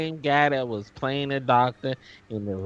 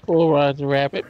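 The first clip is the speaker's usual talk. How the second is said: ordinary delivery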